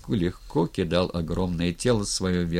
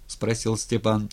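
usual speaking voice

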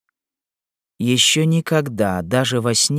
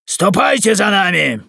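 high, loud command; shouted order; 9 semitones higher, 5 dB louder than usual. Acted. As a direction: call out the order like a patrol leader. Corporal orders the woman along.

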